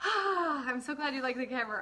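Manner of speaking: ordinary delivery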